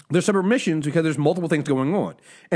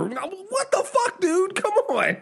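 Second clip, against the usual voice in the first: incredulous; 12 semitones higher, much slower than usual. Real, not acted. Exasperated reaction to show.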